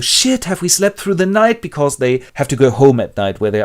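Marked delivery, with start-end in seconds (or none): 0.0-1.6 high startled voice; Julia and Winston waking. Acted. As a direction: jolt awake in alarm. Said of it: "and they go".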